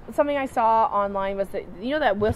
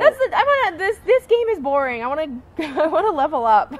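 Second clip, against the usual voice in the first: excited laughing; 7 semitones higher, 6 dB louder than usual. Real, not acted. Excited gamer joke.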